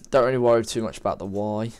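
ordinary delivery

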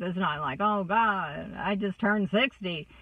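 normal talking voice

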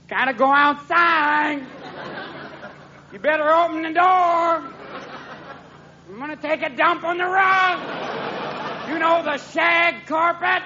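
very high, stretched-out cat yowl; slow, high-pitched nagging cat; demanding cat. Acted. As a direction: whine it high like a cat at the door. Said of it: "your cat wanders downstairs going".

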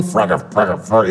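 muttered mock curse words; a quick mocking mutter of fake curses; mock cursing in the head. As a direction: say them like a kid hiding a swear. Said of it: "it's like that Home Alone".

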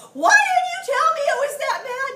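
high, very loud mock shout; hammed-up indignant shout. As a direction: yell it as an upset husband would. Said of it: "he raised his voice at me".

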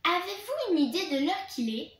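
snarling scold. Acted. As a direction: bark it out like a strict monitor. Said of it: "une voix hargneuse".

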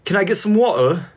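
British accent. Shown as a demonstration. Streamer proving they can say water, put-on British accent. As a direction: ask for water like a Brit. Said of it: "I can say water".

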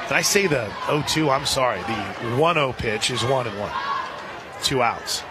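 ordinary delivery

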